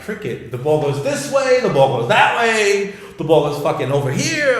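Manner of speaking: hammed-up confused outburst; mock bewilderment. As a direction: act out baffled cricket commentary. Mocks cricket's confusing play.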